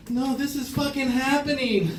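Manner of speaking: high, loud mock panic; hammed-up dread as it becomes real; panicked realisation. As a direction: wail it in alarm. Acts out panic at the exam.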